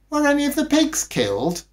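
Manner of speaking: put-on high eager child question. A narrator's reading. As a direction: ask like a worried little kid. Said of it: "child asks eagerly".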